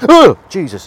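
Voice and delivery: sharp gasp then a loud yelp; jumping out of your skin; screaming at a spider. Real, not acted. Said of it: "I'm starting to sound like a nine-year-old girl".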